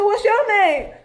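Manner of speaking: laughing high echo of the caller's question; incredulous echo. Retold or imitated. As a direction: throw the question back in shock. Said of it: "I said".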